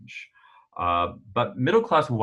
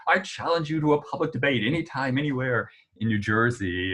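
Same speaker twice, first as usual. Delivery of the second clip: higher-pitched retold heckle, quick at first; mock shouted interruption; 6 semitones higher than usual. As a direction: imitate a heckler cutting in, without truly shouting. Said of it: "she, like, shouted out, interrupted him".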